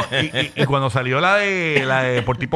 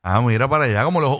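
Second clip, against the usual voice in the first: usual speaking voice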